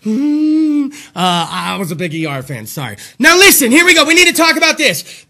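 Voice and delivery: usual speaking voice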